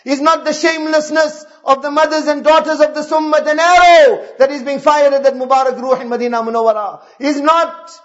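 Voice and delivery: forceful exhortation with surging stressed words; impassioned preaching. Real, not acted. Preacher lamenting the ummah's state today, impassioned full-force exhortation. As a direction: drive each accusation home, swelling on key words.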